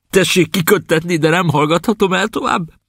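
high, rising outburst; excited. Acted. Prisoner confessing to the captain, suddenly excited. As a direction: let it burst out of him. Prisoner bursts out about the sea.

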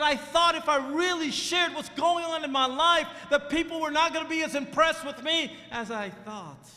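loud, high fiery sermon delivery; preaching at full force. Acted. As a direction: preach it hard to the teens. Preaching the lie of Satan.

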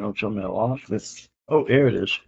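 ordinary talk

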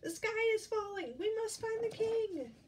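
very high, shrieky alarm cry; high hammed-up bird chorus; squawking and quacking. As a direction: squawk it out as panicked birds. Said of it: "squawking and clucking and quacking".

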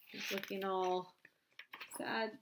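ordinary delivery, nothing marked

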